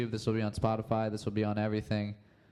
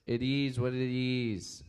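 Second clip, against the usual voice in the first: lazy slow echo of the video line; slowly drawled like a chill group chant; slow drawn-out catchphrase; slower than usual. Retold or imitated. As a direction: stretch each word out slowly, savoring it. Repeating catchphrase from funny video.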